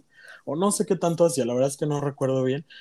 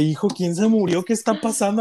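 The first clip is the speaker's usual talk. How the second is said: startled mother; 5 semitones higher than usual. Retold or imitated. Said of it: "Mi mamá así asustada".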